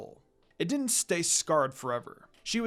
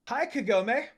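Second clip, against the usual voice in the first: shouted from afar; 6 semitones higher than usual. A narrator's reading. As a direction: call out across the field. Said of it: "A female voice shouted far away".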